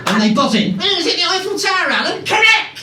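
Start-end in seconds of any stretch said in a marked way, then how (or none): 0.8-2.2 high, dim contestant voice; mock contestant. Comic as a dim contestant answering the host, high mock voice. 2.2-2.8 high, loud hammed-up host "Correct!"; mock host cheer. Comic mimicking the host announcing a right answer, high hammed-up cheer.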